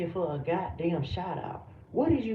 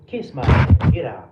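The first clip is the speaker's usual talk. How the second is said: much louder, shouting at the chatter; furious shout; 5 semitones lower, 14 dB louder than usual. Real, not acted. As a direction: yell him out of the stream. Streamer kicking viewer from live.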